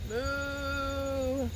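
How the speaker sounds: long drawn-out moo; cow moo. Shown as a demonstration. Librarian mooing for children like a cow. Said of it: "Can you moo like a cow?".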